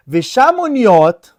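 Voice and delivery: usual speaking voice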